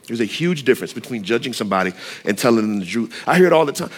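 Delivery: normal talking voice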